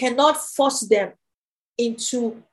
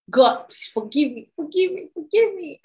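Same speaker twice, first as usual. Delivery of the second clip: pleading, rising higher on each forgive me; desperate plea; 5 semitones higher than usual. Retold or imitated. Student alone in dorm begging God, rising plea. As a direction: beg for forgiveness, voice climbing.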